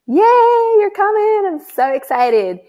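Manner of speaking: high, loud excited cheer; squealing joy. Real, not acted. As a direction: bubble over for the winner. Celebrating second winner.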